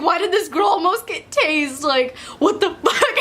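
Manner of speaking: the question broken by laughter; laughing retelling. Retold or imitated. Laughing at classmates' reaction.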